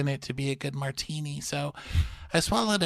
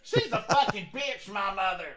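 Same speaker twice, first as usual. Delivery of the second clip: mock cursing rant; 8 semitones higher than usual. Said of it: "the clip of her going".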